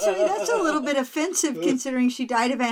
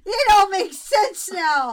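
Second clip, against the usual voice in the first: excited realization; 7 semitones higher, 5 dB louder than usual. Real, not acted. Stunned realization.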